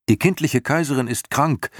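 put-on high eager boy asking; young boy voice. Boy hero urges.

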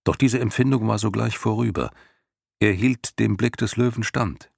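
plain reading voice

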